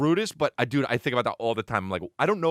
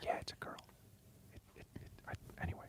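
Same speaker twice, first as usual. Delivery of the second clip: barely audible whisper; faint, hushed ASMR question; 20 dB quieter, faster than usual. Pretend. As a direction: whisper very softly into the mic. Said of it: "Can you do some A S M R".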